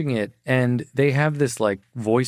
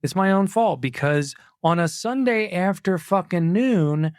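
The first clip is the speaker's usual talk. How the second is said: ordinary delivery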